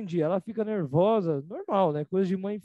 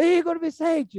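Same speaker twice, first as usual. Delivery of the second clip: Igor Vicente called high and loud, falling hard; a mother warning her son he is in trouble; stern mother calling; 8 semitones higher, 5 dB louder, slower than usual. Retold or imitated. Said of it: "ela muda o tom da voz dela". (mãe brava chamando Igor Vicente com tom de bronca)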